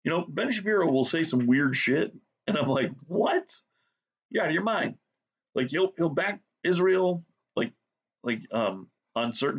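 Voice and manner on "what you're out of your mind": high-pitched retold disbelief; incredulous. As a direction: pitch leaps up on a baffled reaction. Acts out own disbelief at Shapiro.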